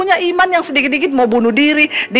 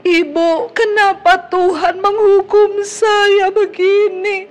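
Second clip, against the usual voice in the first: imitating a weeping woman, higher and drawn out slowly; tearful complaint; 6 semitones higher, much slower than usual. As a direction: plead slowly and high, half-crying, like the caller on the phone. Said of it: "Dia telepon saya sambil nangis". (menirukan suara perempuan menangis, tinggi dan pelan)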